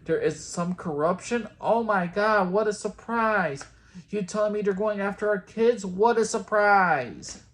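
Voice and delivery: sarcastic over-the-top gasp, high and loud; mock shock, dripping with sarcasm; fake surprise. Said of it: "All the characters are shocked and surprised".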